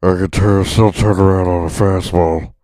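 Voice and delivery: loud, winded hammed-up fat ballplayer; overweight player impression. Heavy player gasping through a game, loud and winded. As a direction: puff and gasp as if out of breath. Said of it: "three hundred and eighty pounds".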